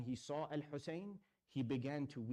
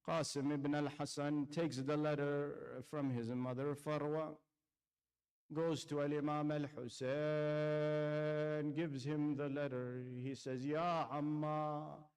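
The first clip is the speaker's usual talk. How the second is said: impassioned preaching. Acted. Preacher recounting Ashura to mourners, impassioned lament. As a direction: pour grief into every phrase, building. Preacher's rising Karbala recitation.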